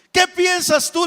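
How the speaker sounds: sharp, high, loud demand; king confronting his servant, loud and high; angry king. Acted. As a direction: fling the question at him.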